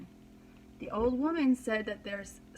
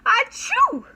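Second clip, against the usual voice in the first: big high hammed-up sneeze; loud sneeze; 12 dB louder than usual. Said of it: "The old woman sneezed".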